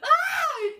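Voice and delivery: loud, high shrieking laugh; mocking cackle. Narrator taunting the rival, loud high cackle. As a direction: burst into a shrill, loud cackle. Bursts out at her own jab.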